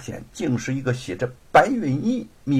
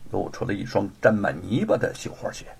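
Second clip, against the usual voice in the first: ordinary delivery, nothing marked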